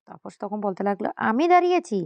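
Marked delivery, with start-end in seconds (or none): 1.3-2.0 high, louder incredulous retort; indignant echo question. Acted. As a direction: throw the accusation back, pitch leaping up. Retold retort to an accusation.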